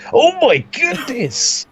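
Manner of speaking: high, drawn-out shocked cry; excited. Real, not acted. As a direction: gasp it out in real shock. Commentator reacts to big move.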